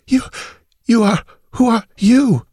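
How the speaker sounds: put-on high, halting gasps from a wounded girl; gasping words. A narrator's reading. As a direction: gasp it out in broken pieces. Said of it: "Her words came in gasps".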